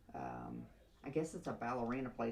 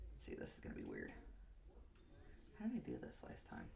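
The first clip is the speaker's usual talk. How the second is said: quick whispered aside to self; hurried hushed self-talk; 13 dB quieter, faster than usual. Real, not acted. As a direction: mutter it fast under your breath. Aside while working on hair.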